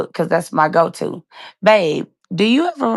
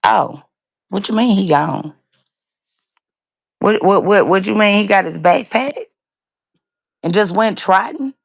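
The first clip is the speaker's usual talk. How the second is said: recalling her confused thoughts on hearing the news, disbelieving; disbelieving inner voice; 3 semitones higher than usual. Retold or imitated. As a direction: replay your confused shock, a little higher. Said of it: "My thought process was".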